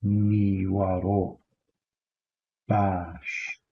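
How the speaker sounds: "niwaro" drawn out slowly, then "bash"; Kurdish niwaro bash. Shown as a demonstration. Teacher models Kurdish good afternoon, slow and clear. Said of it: "good afternoon simply would be".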